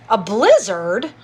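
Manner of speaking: can't believe the weather news; surprised. Real, not acted. Reacting to viewer's news.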